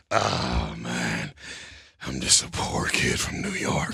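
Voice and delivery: very low, softened humble voice; Vin. Retold or imitated. Comedian imitating co-star dodging compliments, low soft humble voice. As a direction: drop far down, modestly. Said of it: "you know what his favorite line is".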